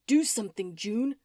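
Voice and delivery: loud, urgent call for help; screamed. A narrator's reading. Oscar screaming at June to do something. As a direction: yell at her to act. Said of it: "Oscar screamed".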